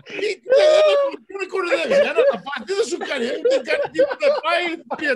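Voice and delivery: high, loud protest broken by laughter; laughing protest. Retold or imitated. Arguing with the burger guy, laughing and loud. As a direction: argue back while cracking up. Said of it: "I go".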